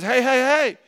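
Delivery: high, loud urgent calls; the finger raising the alarm; hurt finger calling out. Retold or imitated. Preacher voices a hurt finger to the congregation, loud alarmed calls. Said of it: "Your finger says".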